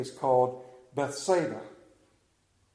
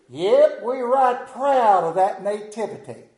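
southern drawl; 4 semitones higher, 6 dB louder than usual. Retold or imitated. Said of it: "deep southern drawl".

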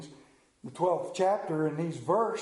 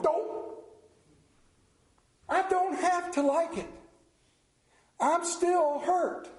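whiny pouting voice, much higher, slowing to a drag; Homer Simpson moment; 11 semitones higher, slower than usual. Acted. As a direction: sulk like a hurt cartoon dad. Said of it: "He had his Homer Simpson moment".

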